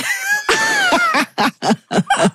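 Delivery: high, bursting laugh of recognition; sudden shrieking laugh; real laugh. Real, not acted. Amused reaction to punchline.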